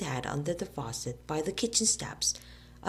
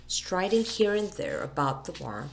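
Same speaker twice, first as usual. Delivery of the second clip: usual speaking voice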